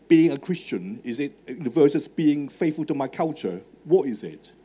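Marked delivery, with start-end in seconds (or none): none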